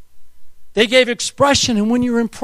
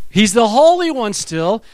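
ordinary delivery

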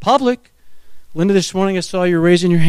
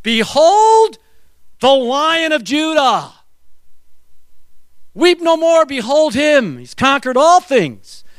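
booming elder proclaiming the Lion; 5 semitones higher, slower than usual. Acted. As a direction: announce it like a herald in glory. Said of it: "an elder says".

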